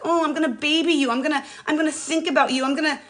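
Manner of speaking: put-on high, cooing baby talk to the pain; doting singsong to an aching hip; mock doting voice. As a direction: coo over it like a fussed-over baby. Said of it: "We're like".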